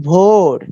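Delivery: ভোর with the "o" held long; long vowel. Shown as a demonstration. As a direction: draw ভোর out as one long syllable. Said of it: "ও এর উচ্চারণ একইভাবে দীর্ঘ হবে".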